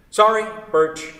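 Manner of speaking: flustered correction. Acted. Flustered self-correction after slipping.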